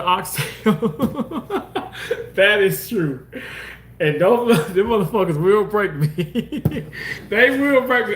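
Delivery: talking through laughter; giggling at her own joke; laughing about expensive oxtails. Real, not acted.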